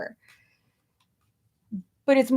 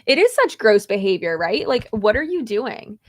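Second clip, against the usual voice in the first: ordinary delivery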